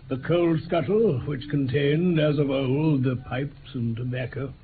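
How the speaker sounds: first-person narrator setting the scene in Baker Street; story narration. A narrator's reading. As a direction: tell it back as a remembered tale.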